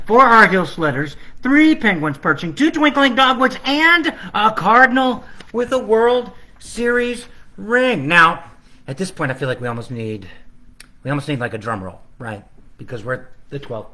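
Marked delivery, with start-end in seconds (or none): 0.1-8.1 countdown climbing higher, louder toward the end; building carol countdown. Acted. Illustrator building his countdown to the grand finish. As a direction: build it up to the cardinal.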